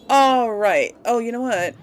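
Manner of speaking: ordinary talk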